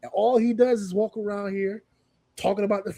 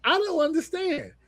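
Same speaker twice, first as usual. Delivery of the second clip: exasperated Seth; 9 semitones higher than usual. Retold or imitated. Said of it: "He said".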